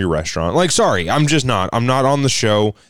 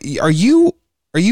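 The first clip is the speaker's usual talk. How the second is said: higher-pitched, disbelieving stammer; incredulous; 7 semitones higher, 3 dB louder than usual. Retold or imitated. Comedian retelling his reply to the manager, incredulous. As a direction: stammer a question in mock disbelief. Retold stunned reply to an accusation.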